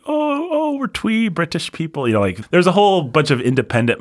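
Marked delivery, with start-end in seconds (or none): 0.1-2.1 very high, prissy put-on British voice; squeaky parody of a twee Brit; mock twee Brit. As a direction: mince through it like a twee Brit.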